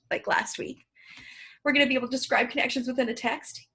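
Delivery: ordinary talk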